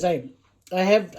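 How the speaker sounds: ordinary talk, nothing marked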